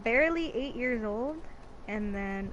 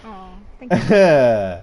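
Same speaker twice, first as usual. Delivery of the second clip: touched aw; 3 semitones lower, 16 dB louder, slower than usual. Real, not acted. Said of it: "Just like you".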